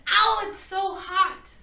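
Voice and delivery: loud, high yelp, then slow it's so hot; startled pain at hot porridge; sudden ow. A narrator's reading. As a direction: burnt tongue, blurt it out. Burns mouth on porridge.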